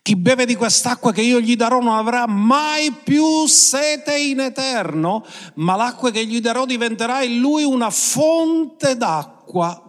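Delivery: declaimed high, ringing scripture quote; solemn preacher's quote; quoting Jesus. Acted. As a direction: proclaim the promise to the crowd. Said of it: "Che Gesù dice".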